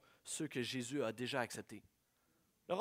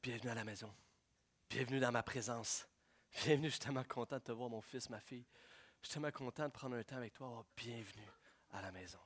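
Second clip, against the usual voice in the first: tender welcome; 4 semitones lower, 6 dB quieter, faster than usual. Acted. Voicing Jesus welcoming.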